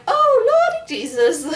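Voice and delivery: high squeal breaking into a cry; frightened squeal. Retold or imitated. Stuck at the top of the ride, squealing in fear. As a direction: squeal out a panicked prayer. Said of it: "And you're just like".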